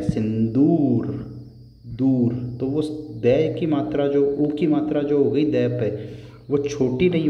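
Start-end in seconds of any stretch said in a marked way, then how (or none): 0.0-1.1 सिंदूर with the ऊ drawn out slowly; stretched long vowel. Shown as a demonstration. Teacher stretches the ऊ in सिंदूर for students. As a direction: lengthen the ऊ in सिंदूर. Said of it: "ऊ की मात्रा उसको अपन खींच रहे हैं".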